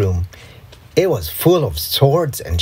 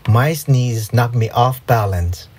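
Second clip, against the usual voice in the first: ordinary delivery, nothing marked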